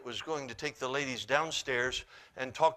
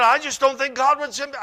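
loud, high, upset objection; insistent protest; 11 semitones higher, 13 dB louder than usual. Retold or imitated. Woman still arguing with the preacher, upset protest. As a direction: repeat her objection, raised and emphatic. Acted protest in retold argument.

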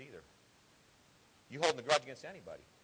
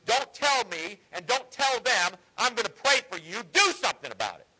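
raised, high, emphatic preaching; forceful rebuke; 5 semitones higher, 5 dB louder than usual. Real, not acted. Preacher rebukes churches ignoring struggling members.

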